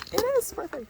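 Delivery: excited. Real, not acted. Repeats with rising excitement.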